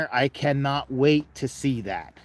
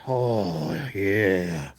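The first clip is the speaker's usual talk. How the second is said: normal talking voice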